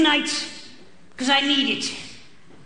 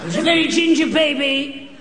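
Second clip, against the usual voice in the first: higher, insistent comic declaration; pitched-up, emphatic restatement; forceful repeat; 5 semitones higher, 4 dB louder than usual. Acted. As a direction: push the line out over the interruption. Repeats line forcefully after interruption.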